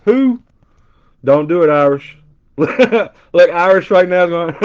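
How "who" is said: mock who. Joking disbelief about [name].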